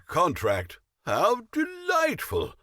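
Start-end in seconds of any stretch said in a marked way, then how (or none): 1.1-2.5 delighted. Acted. Delighted at getting contract.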